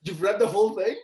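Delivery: high, loud disbelief; astonished question. Real, not acted. Host learns co-host read it all, astonished. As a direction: pitch leaps up, incredulous.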